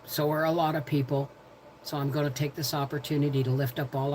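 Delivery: low, soft, measured prayer voice. Real, not acted. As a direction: lift them up to God, softly and evenly. Leads a prayer.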